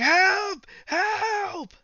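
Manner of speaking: high, drawn-out cry for help; yelling for help. Acted. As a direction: yell like a panicked king in falsetto. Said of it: "The king starts yelling".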